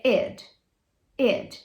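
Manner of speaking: short id syllable; ending sound id. Shown as a demonstration. Teacher showing the closest sound. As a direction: model id cleanly. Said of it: "o que chega mais próximo é I D".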